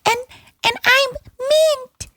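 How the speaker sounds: very high, slow put-on co-host voice; Mint. Host introducing self as absent co-host, squeaky impression. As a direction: stretch it out in a squeaky falsetto. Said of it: "Mint, you sound a little different today".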